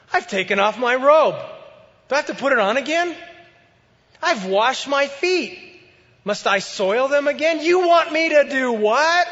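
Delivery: high, loud indignant protest; indignant wife. Acted. Wife refusing her husband at the door, indignant and high. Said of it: "and she says".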